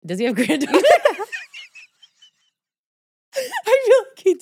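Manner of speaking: high-pitched laughing words. Real, not acted. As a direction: giggle while unsure. Unsure, laughing at herself.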